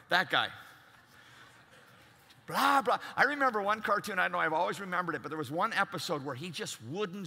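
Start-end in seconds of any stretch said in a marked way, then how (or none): 2.5-3.0 mocking babble. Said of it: "this blah, blah, blah".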